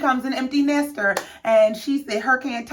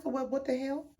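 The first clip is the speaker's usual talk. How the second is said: much softer, under-the-breath reaction; quiet, baffled aside with slightly raised pitch; taken aback; 4 semitones higher, 13 dB quieter than usual. Retold or imitated. Viewer reacting to the house revelation, quietly baffled.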